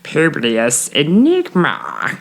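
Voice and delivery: slow drawn-out weird voice on Publius Enigma. Pretend. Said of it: "everybody just do a weird voice really quick".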